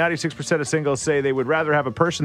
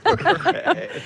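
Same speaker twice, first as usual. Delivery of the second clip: bright laugh, much higher; high burst of laughter. Real, not acted. Host laughing at Jack's joke. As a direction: break into a real laugh. Jack makes her laugh.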